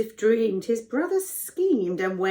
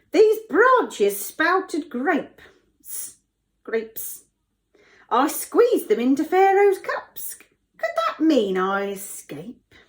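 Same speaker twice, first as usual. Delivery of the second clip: high, slow character voice; cupbearer voice; 7 semitones higher, 5 dB louder, slower than usual. Acted. Cupbearer recounts his dream to Joseph, in character voice. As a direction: play the cupbearer, drawn out and high. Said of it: "Now we can do voices".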